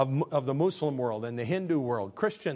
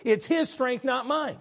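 high, loud preaching peak; preaching climax; 12 semitones higher, 5 dB louder than usual. Acted. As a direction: cry it out like a revival preacher.

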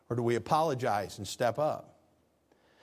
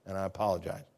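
sincere apology; 6 semitones lower, 5 dB quieter than usual. Shown as a demonstration. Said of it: "Apologize and go".

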